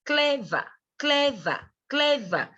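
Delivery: teacher modelling the two syllables of clever; syllable drill. Shown as a demonstration. Teacher drills the word for students, clearly pronounced. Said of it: "okay, clever".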